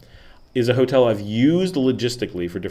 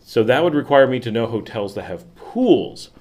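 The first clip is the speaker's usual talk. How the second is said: ordinary delivery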